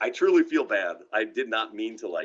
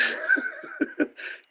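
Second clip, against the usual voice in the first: laugh; 4 dB quieter than usual. Real, not acted. Laughing at own story.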